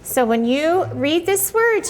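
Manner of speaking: normal talking voice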